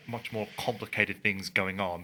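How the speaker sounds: ordinary talk, nothing marked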